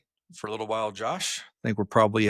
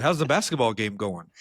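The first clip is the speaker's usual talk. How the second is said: ordinary talk, nothing marked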